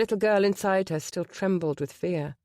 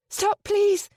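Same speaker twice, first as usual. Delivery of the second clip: high, loud desperate plea; crying out; 6 dB louder than usual. A narrator's reading. Penny begging the men to stop fighting, high loud cry. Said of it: "Penny cried".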